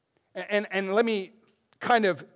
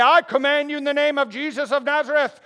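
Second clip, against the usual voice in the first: louder, higher movie-exorcist command; mock exorcist; 6 semitones higher, 7 dB louder than usual. Preacher mocking magic-word exorcists, a hint of hammed-up command. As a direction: ham up a Hollywood exorcism. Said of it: "He didn't say".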